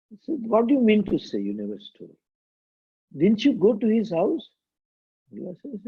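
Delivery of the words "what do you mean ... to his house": high, insistent mock cross-examination; storyteller's high, indignant judge; incredulous judge. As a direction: question him in acted disbelief, pitched up. Judge questions Mullah in a joke.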